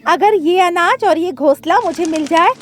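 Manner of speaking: high, loud, delighted crow voice; greedy crow. A narrator's reading. Crow gloating to himself over the grain, greedy. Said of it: "Kalu कौवा के मन में लालच आ गया".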